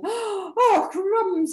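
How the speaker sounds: high, loud mock fright; alarmed snake. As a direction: yelp in storybook panic. Snake sees the Gruffalo.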